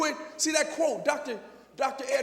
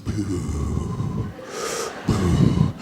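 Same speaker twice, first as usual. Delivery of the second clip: low, loud spooky boo; mock scary boo; 9 semitones lower, 8 dB louder, much slower than usual. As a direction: scare the kids in fun. Mock scary coach intro.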